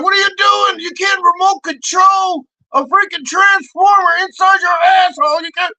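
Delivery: very high, loud comic protest; retold exasperated yell. Comic retelling his scolding of Santi, exasperated shout. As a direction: shout it in high-pitched disbelief.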